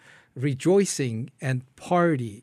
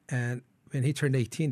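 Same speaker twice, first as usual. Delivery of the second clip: normal talking voice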